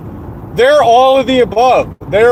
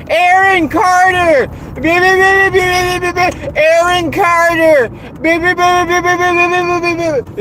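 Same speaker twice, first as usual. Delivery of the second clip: mocking chat crowd; 9 semitones higher than usual. Imitating heathens who sit in voice chat all day, mocking. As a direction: mimic mindless chatter way up high. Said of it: "it was just".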